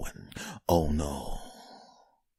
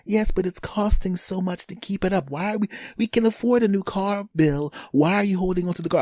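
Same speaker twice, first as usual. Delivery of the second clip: high, nagging wife voice; 10 semitones higher than usual. Retold or imitated. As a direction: nag your husband about the car. Wife nagging Bill about car.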